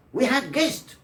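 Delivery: had guest said as "hag guest"; assimilated had guest. Shown as a demonstration. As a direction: drop the D of had into the G. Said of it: "but not had".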